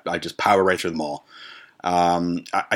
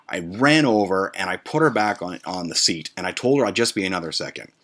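ordinary talk

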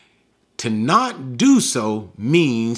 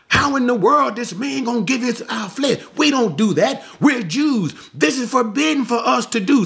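high, indignant protest of the crowd; heated protest; 9 semitones higher than usual. Acted. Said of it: "saying in the heated sense".

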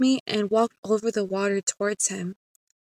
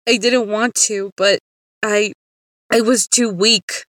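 louder, halting admission of weakness; pleading and broken up by pauses; anguished confession; 6 dB louder than usual. A narrator's reading. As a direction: burst out with a halting, tearful excuse. Tearful confession, breaking out louder.

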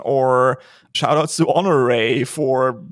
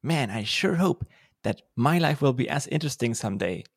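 lightly voiced naive eighth grader, soft; a hint of a dreamy schoolkid; hopeful kid; 5 dB quieter than usual. Meme voice of a hopeful eighth grader. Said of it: "me as an eighth grader".

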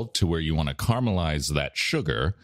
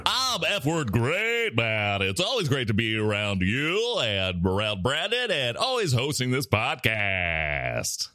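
mock enthusiastic voice; 5 semitones higher than usual. Continues mock hosting voice.

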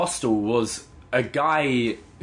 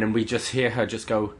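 usual speaking voice

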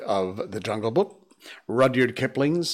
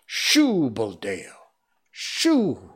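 shooing shout; much slower than usual. Acted. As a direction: drive him off like a stray. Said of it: "villagers chasing Mowgli off".

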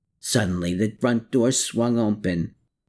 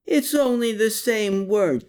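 growl; 11 semitones higher than usual. A narrator's reading. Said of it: "Klaus growled".

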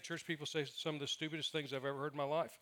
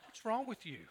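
thought, mock indignant; 5 semitones higher, 4 dB quieter than usual. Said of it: "That's what I thought".

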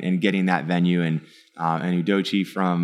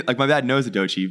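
ordinary delivery

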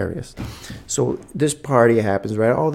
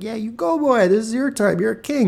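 much higher, hyped encouragement; cheering on; 10 semitones higher than usual. Retold or imitated. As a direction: pump the kid up like a proud dad. Cheering son on with wink.

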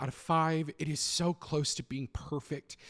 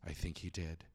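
devilish Riku; 8 semitones lower, 6 dB quieter than usual. Acted. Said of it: "smiles devilishly and goes".

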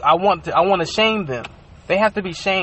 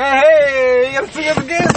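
excited call; 6 semitones higher, 4 dB louder than usual. Retold or imitated. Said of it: "I picked him up like".

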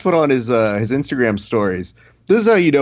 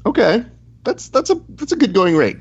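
surprised high; 9 semitones higher than usual. Real, not acted. Reacting to price, voice leaps.

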